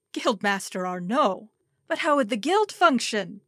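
loud, rising protest; outraged shout. A narrator's reading. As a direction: cry out against the demand. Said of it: "shouts of dismay and rage".

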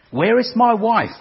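choked cry. Acted. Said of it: "he choked".

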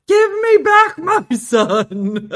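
slow, loud, theatrical growl; deep voice. Retold or imitated. Mom picking up kids, booming a mock threat. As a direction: boom it like a movie villain. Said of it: "I always say to deep voice".

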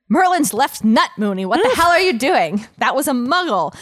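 loud, high angry shout; furious yell at Moony; angry outburst. Acted. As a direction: lash out at him. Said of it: "lashed out at the nearest target".

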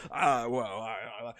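low, trailing faltering stammer; mock hesitant stammer. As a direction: falter like someone caught on camera. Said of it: "They go".

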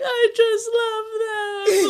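high, slow, teary wail; imitating her own sobbing at the wedding; retold crying. Telling a friend about crying at the wedding, high teary wail. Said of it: "Like, I was crying".

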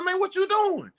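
mock-startled "what you doing"; startled outcry. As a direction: yelp it up high in disbelief. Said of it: "And I said".

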